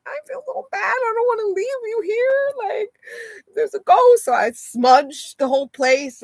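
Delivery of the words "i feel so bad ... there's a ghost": high, pained pleading voice; mock distressed baby talk. Telling her dog she hates leaving it, high and pained.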